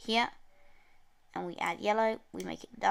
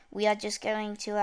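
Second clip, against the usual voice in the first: ordinary talk, nothing marked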